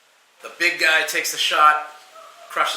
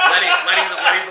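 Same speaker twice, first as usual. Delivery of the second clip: high giggle breaking through the name; laughing; 4 dB louder, faster than usual. Real, not acted. Telling cohost about Lenny, laughing high.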